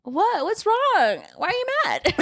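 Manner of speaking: high, rising mock-innocent questions; feigned innocence. Friend mimicking Sasha on the podcast, high mock innocence. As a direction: play dumb with wide eyes.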